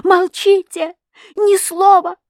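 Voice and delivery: very high, loud cry; desperate shout. A narrator's reading. Girl lunges to silence the husband, desperate shout. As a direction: cut him off in a frantic high voice. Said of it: "закричала я, бросаясь вперёд".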